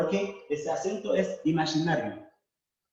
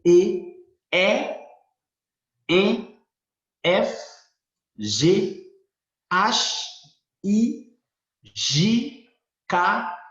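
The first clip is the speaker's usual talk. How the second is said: letters called out one by one, louder; alphabet recitation; 3 semitones higher, 4 dB louder, much slower than usual. Shown as a demonstration. Teacher reciting Creole alphabet letters aloud. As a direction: call each letter out clearly for the class.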